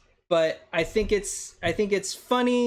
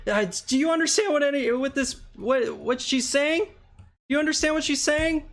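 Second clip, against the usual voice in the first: high, fast panicked questions; confused panic; 7 semitones higher than usual. Acted. Reed asking if anyone understands the monster, high fast panic. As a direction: babble in bewildered alarm. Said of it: "Reed's like".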